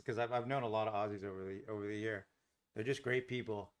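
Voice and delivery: ordinary delivery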